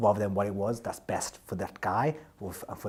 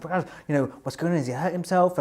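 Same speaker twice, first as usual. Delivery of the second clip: alarmed question; 8 semitones higher, 4 dB louder than usual. Retold or imitated. Worried question to colleague at night.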